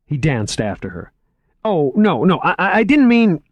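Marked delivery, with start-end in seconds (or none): 1.6-3.4 flustered rising stammer, much higher and louder; tripping over words in panic; horrified, stumbling apology. Acted. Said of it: "he stammered".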